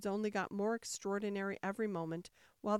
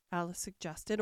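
ordinary delivery, nothing marked

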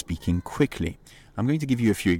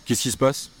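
higher and louder, urgent; raised alarmed question; 5 semitones higher than usual. Shown as a demonstration. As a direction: ask it sharply. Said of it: "depending on how you use your voice".

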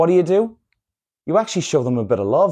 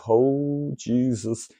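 mild dread; 5 dB quieter, much slower than usual. Acted. Spotting your ex in the bar, understated dread. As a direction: let it sink out softly with dread. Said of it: "you have that dreaded".

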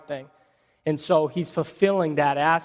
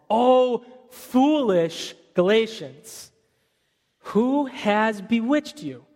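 slow, higher, drawn-out rebuke; rebuking Paul; 5 semitones higher, slower than usual. Acted. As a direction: lean into the scolding. Paul rebukes the Galatians, read aloud.

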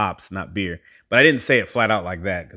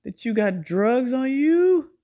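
much higher, squealing mock-lecture; exasperated scolding; 14 semitones higher, 4 dB louder than usual. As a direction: shriek the advice like an outraged auntie. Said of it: "I said".

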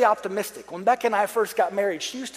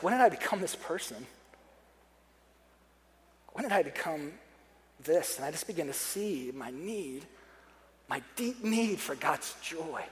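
lower, softer, soul-searching voice; subdued confession; 4 semitones lower, 8 dB quieter than usual. Retold or imitated. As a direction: confess it quietly, as if to yourself. Confesses his own frightening jadedness.

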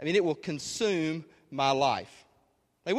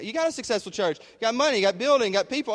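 higher-pitched imitated challenge; acted-out pushback, pitched up; mock objection; 7 semitones higher, 4 dB louder than usual. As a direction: list it off as a heckling listener. Acting out an objecting listener.

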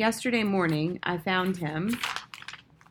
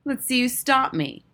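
put-on higher-pitched boyish challenge; defiant dare; 6 semitones higher, 4 dB louder than usual. A narrator's reading. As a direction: taunt like a cocky little bully. Bully dares the narrator.

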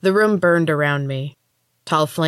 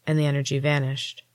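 ordinary delivery

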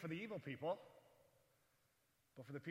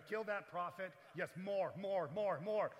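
mock battle cry; 4 dB louder, slower than usual. Playing God firing at Jonah.

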